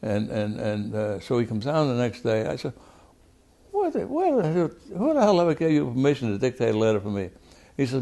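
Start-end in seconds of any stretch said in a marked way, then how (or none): 3.7-7.3 high start, then rapid indignant questioning; retold outraged demand. Congressman confronting Roy face to face, outraged. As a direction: re-enact demanding who gave permission.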